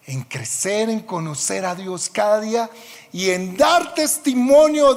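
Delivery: sermón a plena voz, más agudo y fuerte; predicación. Acted. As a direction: clama a la congregación con fervor. Predica con toda fuerza. (predicador clamando con fervor a la congregación)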